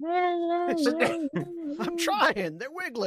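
high, strained "I'm trying"; strained high character voice. Acted. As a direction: squeak it out like you're struggling. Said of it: "as the guy's like".

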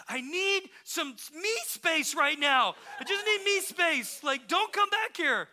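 put-on high, loud kid outburst; imitated angry child. Child to sibling crawling into the back, mock furious. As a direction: act out a child yelling to be left alone. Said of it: "And he just said".

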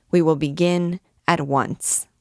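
ordinary delivery, nothing marked

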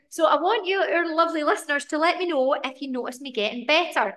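ordinary delivery

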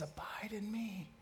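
whispered, intimate; whispered invitation. Acted. Jesus softly invites them to stay in his love, whispered. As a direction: breathe it like a secret.

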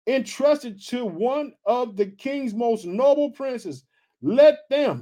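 slow, high-pitched Bible reading; scripture reading. A narrator's reading. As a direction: stretch out the verse for the congregation. Reads scripture aloud.

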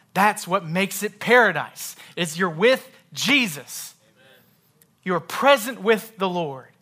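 loud, weighty pulpit emphasis; emphatic preacher, leaning on key words; full-force preaching. Real, not acted. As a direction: proclaim it high and loud.

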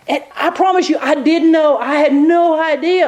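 lightly voiced lawyer protesting she had no idea; lawyer swearing innocence. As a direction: plead you didn't know, with a chuckle. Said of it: "She goes".

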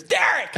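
high laughing sneeze shout; name sneezed. Pretend. Sneezing the name while cleaning ears. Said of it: "When I'm cleaning out my ears".

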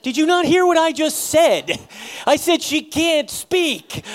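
high, loud, exasperated snap; mock fury at the nurse, hammed-up; aggressive retort. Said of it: "I didn't say it that aggressive".

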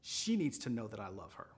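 a hushed, low instruction from God; lightly voiced God. God nudging a shopper at the register, lightly voiced, low. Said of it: "I hear God say".

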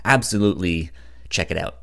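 usual speaking voice

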